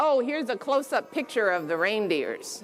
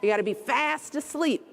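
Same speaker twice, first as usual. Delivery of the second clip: usual speaking voice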